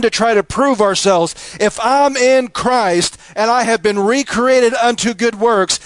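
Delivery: high, impassioned preaching that climbs and swells; preacher pleading with the congregation to stop chasing the world's approval, impassioned and high; fiery preaching. Acted. Preacher urging the congregation to cast their cares on the Lord, fervent and high. Preaching builds to full force.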